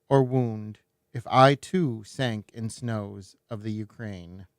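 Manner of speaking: deliberate, weighted poetry reading; measured literary recitation with firm emphasis; poem recitation. Acted. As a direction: recite each phrase with care, stretching the line endings. Reads the English translation of the poem.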